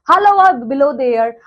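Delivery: ordinary delivery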